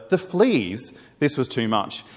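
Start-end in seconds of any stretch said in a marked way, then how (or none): 0.1-1.0 incredulous. A narrator's reading. Corrie balks at thanking for fleas.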